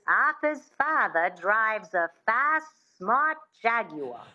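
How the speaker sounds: slow Mid-Atlantic accent with a light twang; careful Mid-Atlantic accent demo. Shown as a demonstration. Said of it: "that sound".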